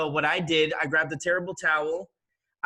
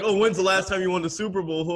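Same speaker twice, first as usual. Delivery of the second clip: higher, louder mocking comeback; taunting fans; 5 semitones higher, 5 dB louder than usual. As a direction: imitate a jeering fan's retort. Imitates fans taunting him back.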